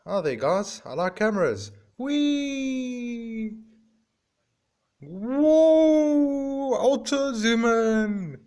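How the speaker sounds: high, excitable pigeon, swooping into loud squeals; pigeon character voice. Pretend. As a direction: play a pigeon thrilled by the camera. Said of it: "I don't know why my voice changes".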